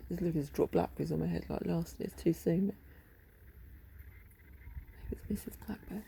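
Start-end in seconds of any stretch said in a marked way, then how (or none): 5.1-6.0 playful whisper. Real, not acted. Talking about a bird.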